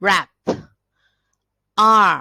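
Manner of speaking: ordinary delivery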